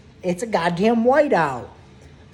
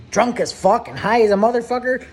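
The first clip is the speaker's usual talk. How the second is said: ordinary talk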